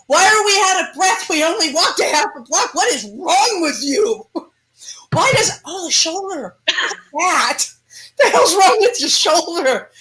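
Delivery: high, whining complaint; whining demon. Acted. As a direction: whine like a worn-out demon.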